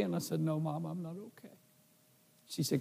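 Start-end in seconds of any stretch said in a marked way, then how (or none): 0.4-1.5 very soft, understated tearful reply; retold tearful admission. Tearful son admitting trouble to his mom, retold very softly. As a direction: quietly retell admitting it through tears. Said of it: "the tears were just streaming down my face".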